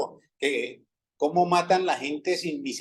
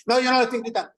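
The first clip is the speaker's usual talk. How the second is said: retold shouting denial; 6 semitones higher, much faster than usual. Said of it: "No me grite".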